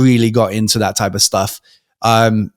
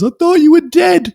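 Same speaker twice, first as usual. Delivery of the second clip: much higher, tearful cracked pitch; crying relief. Retold or imitated. Crying friend hugging him after the crash, tearful and high. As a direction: choke it out through tears of relief. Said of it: "He starts crying".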